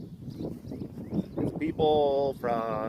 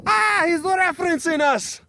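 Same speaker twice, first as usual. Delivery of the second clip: much higher, louder chirpy voice; delighted Scot; 14 semitones higher, 4 dB louder than usual. Acted. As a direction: pipe up like a pleased Scot. Scottish viewers react.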